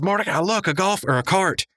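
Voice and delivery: high, eager cartoon shout-out; excited discovery. Rigby spotting the golf cart to Mordecai, high and eager. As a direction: point it out in a burst of excitement.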